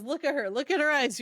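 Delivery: acted-out loud, urgent order; retold urgent warning. Guard telling his friend to look at her eyes, urgent retold warning.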